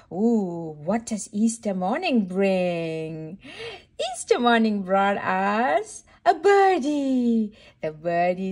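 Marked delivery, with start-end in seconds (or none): none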